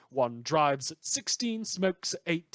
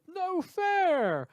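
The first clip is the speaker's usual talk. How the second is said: put-on much higher and slow, like a sulking kid; mock childish whine; 14 semitones higher than usual. Host mocking the cape rules, childish whine. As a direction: whine it like a playground complaint.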